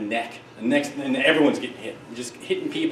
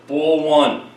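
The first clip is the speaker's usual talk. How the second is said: lightly voiced umpire calling a pitch; umpire's call; 5 dB louder than usual. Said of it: "Umpire, with it was South Philly".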